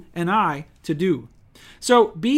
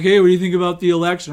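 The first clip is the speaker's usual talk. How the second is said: quick, louder mock small talk; nosy churchgoer; 6 dB louder, much faster than usual. Preacher mocking election small talk, a quick, louder mock chatty voice. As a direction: rattle it off like a pushy chatty churchgoer. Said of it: "be like".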